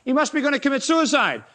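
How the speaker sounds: high scoffing "Ha!" then jeering; hammed-up Pharisee ridicule; mocking. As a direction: scoff at him like a mocking crowd. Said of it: "they laughed and mocked and said".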